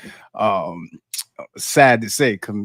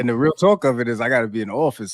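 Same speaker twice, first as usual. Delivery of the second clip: usual speaking voice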